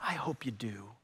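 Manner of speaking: soft unvoiced whisper. Real, not acted. Pastor privately hoping for listeners, whisper. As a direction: breathe it to them gently. Tender aside to congregation.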